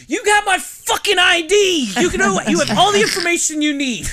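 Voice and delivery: put-on irate drunk shouting. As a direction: yell it in outrage, leaning on the swear.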